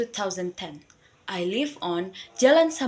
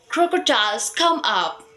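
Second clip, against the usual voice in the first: louder call across the river; calling out loudly; 5 dB louder than usual. A narrator's reading. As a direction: summon the crocodiles, louder. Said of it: "he said out loudly".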